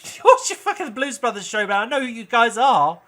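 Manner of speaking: loud, high exasperated yell; angry shout. Acted. Cop yells at the band, furious. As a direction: bellow it in disbelief. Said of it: "He goes".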